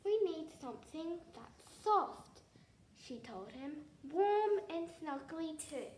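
eager little princess; bright excited. Acted.

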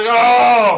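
loud, high "oh"; big cry of dismay at the play; outburst. Real, not acted. Player watching the play go wrong, loud high cry.

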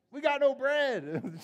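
high, loud, drawn-out mock wail; hammed-up complaint. Disciples fret over bread while Jesus teaches, hammed-up wail. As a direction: cry it out slowly like a comic whiner. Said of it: "what are they thinking about?".

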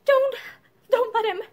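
loud, high frantic plea; sobbing cry. A narrator's reading. Mother begging a friend to intervene, crying out sobbingly. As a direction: cry out in distress. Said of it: "She cried out sobbingly to Joyce".

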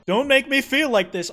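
loud, high mock protest; acted high-pitched fluster; flustered outburst. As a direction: blurt it out, voice jumping up. Acts out the flustered character.

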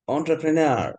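"এন্টারপ্রেনার" as on-tra-pre-near, last syllable stretched; demonstrated pronunciation. Retold or imitated. Teacher repeats the played pronunciation for students, "এন্টারপ্রেনার" imitated as on-tra-pre-near. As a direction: copy a recorded "এন্টারপ্রেনার", opening "on" and ending in a long "near". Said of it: "এরকম বলেছে".

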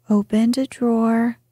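plain reading voice

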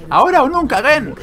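high, loud heroic shout; battle cry. Acted. Acted battle cry from game dialogue.

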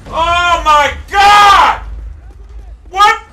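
high, loud bursts of disbelief; enraged shouting. Real, not acted. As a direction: scream in real frustration at the game. Gamer killed, screams at game.

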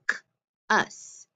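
"k", pause, "us" with short u; sounded out. Shown as a demonstration. Teacher sounding out a short U syllable, sounded out. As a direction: sound out "k ... us" in separate pieces. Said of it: "that U is gonna be short".

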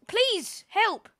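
high pleading cry for help; panicking gamer. Real, not acted. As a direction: beg urgently, voice climbing. Gamer begging mid-fight.

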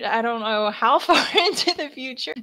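laughing through the answer; words broken by a laugh. Real, not acted. Player laughing mid-answer.

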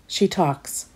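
ordinary delivery

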